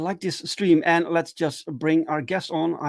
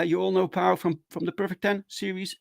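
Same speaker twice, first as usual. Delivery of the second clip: ordinary delivery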